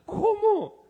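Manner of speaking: high, loud startled cry; shocked cry. Retold or imitated. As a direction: yelp it in shock. Echoes the medium's shock. (grito agudo de sorpresa)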